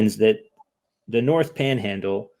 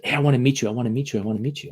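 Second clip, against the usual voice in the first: mock pestering; 4 dB quieter, much faster than usual. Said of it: "gave me a call and said".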